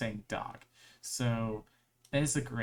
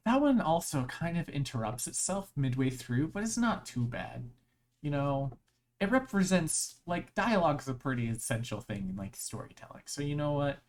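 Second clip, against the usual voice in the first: ordinary talk, nothing marked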